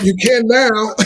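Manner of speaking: loud, high mock-eager reply; comic instant surrender. Husband retelling his reply to disarming wife, loud and comic. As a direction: burst out with it, playing to the laughs. Comic retold reaction to gentle request.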